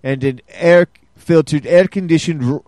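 poor mock Russian ad read; bad Russian accent. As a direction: read the care sheet in a hammy Russian accent. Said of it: "you gotta work on your Russian".

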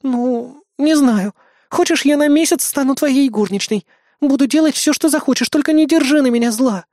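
put-on high, coaxing girl's voice; playful girl. Girl offers to be his maid, coaxing and high. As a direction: bargain sweetly, pitched high.

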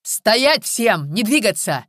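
loud, high-pitched freeze order; shouted command. A narrator's reading. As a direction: burst in and shout at everyone. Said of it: "остановил крик Капицы".

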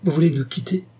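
cri surpris, plus haut; lâche la question dans un sursaut; s'écriant. Acted. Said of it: "s'écria-t-il". (le marquis s'écrie, alarmé)

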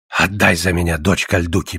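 put-on low old man's request; old suitor. Old man asks for a bride.

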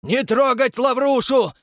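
high, slow furious roar. Acted. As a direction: roar to protect your son. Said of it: "взревел Растопчин-старший".

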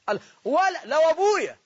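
high, loud burst of wonder; amazed exclamation. Real, not acted. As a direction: marvel out loud at it. Preacher marvelling at the story.

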